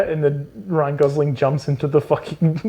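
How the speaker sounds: normal talking voice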